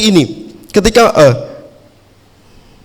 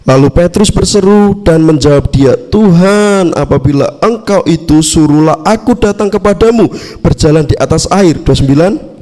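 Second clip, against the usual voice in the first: louder, steady Bible reading to the congregation; scripture reading; 3 semitones lower, 3 dB louder than usual. Acted. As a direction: read the passage out clearly, verse by verse. Reads the Bible passage aloud.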